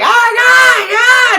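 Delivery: very high, loud panicked cry; mock panicked shout. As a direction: yell it like a terrified crowd. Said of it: "the rest of them are like".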